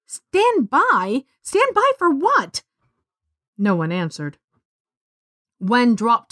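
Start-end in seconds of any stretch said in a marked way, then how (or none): 0.1-2.6 indignant. A narrator's reading. Girl echoes in outrage.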